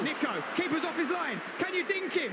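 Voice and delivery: rising, urgent play-by-play call; excited commentary. Real, not acted. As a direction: call the chance as it unfolds. Live chance in front of goal.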